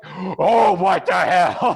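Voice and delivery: hammed-up slow, indignant outburst; imitated outrage. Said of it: "uh, she was pissed".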